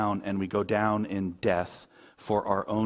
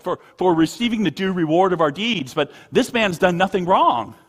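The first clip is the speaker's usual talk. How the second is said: raised, higher, forceful retelling; impassioned rebuke; 8 semitones higher, 10 dB louder than usual. Retold or imitated. Preacher voicing the thief defending Jesus, impassioned and loud. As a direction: imitate the thief's emphatic defence, high and loud.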